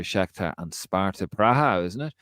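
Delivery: normal talking voice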